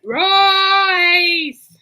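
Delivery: loud, high shout of a name; host greets a friend who just joined with a loud, high call; excited call-out. Real, not acted. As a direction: hail the arriving friend with joy. Greeting a friend joining the stream.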